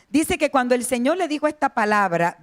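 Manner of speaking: ordinary delivery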